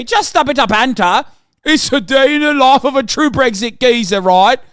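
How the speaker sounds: high, loud cartoon British accent; mock British geezer. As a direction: brush it off as a hammed-up British lad. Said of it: "the British authorities be like".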